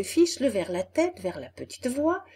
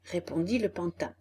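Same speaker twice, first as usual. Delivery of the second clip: ordinary delivery, nothing marked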